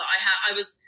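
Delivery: usual speaking voice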